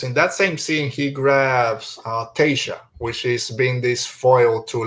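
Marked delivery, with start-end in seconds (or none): none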